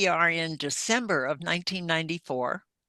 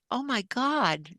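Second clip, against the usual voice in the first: stunned; 8 semitones higher, slower than usual. Retold or imitated. Sister sees welt marks.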